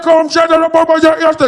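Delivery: high, loud insistent Afrikaans; quarrelling Afrikaner. Acted. Afrikaans fans fighting over a photo, high insistent demand. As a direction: badger urgently in Afrikaans.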